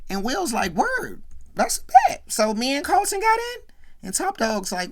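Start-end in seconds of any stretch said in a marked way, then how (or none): 0.7-3.6 a much higher, delighted burst; thrilled at the good news, pitched way up; excited. Retold or imitated. Will, told he got into the fraternity, excited and high.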